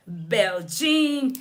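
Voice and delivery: normal talking voice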